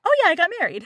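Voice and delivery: high, loud, breezy mock announcement; mock oblivious bragging. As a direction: imitate a clueless bride blurting her news. Voicing oblivious Lydia's breezy news.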